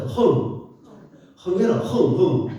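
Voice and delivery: put-on loud sharp nose-blow "흥" sounds, like a parent wiping a child's nose; nose-blowing honk. Shown as a demonstration. Showing the audience how parents made kids blow their nose, loud honks. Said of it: "한번 따라하세요".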